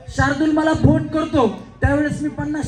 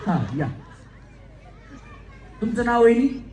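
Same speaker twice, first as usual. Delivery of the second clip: ordinary talk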